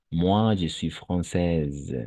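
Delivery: slow model sentence, française ending on a z sound; careful pronunciation. Shown as a demonstration. Teacher correcting a student's reading, slow careful pronunciation. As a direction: stretch française, final e silent. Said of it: "on ne prononce pas".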